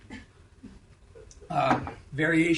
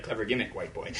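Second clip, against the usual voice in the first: low dismissive mock listener; sceptical listener; 10 semitones lower, 7 dB quieter than usual. Unconvinced audience member, low mock scoff. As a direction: sneer it down low and soft. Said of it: "You're not gonna be like".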